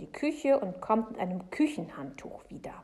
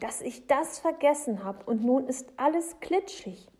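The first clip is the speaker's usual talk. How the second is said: loud, raised pleading; imploring; 6 semitones higher, 5 dB louder than usual. A narrator's reading. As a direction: plead with her apologetically. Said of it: "sagt Jonathan beschwörend".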